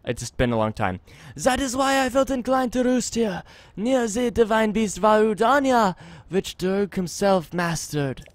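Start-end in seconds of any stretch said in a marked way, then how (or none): 1.4-8.2 put-on lilting, thickly accented bard voice; measured, musical accented delivery of a wandering minstrel; travelling bard with foreign accent. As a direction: read it as a courtly accented bard.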